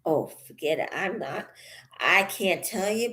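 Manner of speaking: normal talking voice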